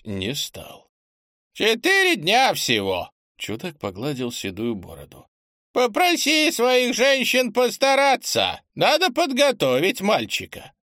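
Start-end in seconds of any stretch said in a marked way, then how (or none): 1.5-3.1 put-on high, loud old man exclaiming; old eccentric voice. Old eccentric character speaks. 5.7-10.7 put-on old eccentric voice. Old eccentric character speaks.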